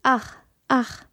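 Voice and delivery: a mechanical doll sighing. A narrator's reading. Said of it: "Olympia seufzte".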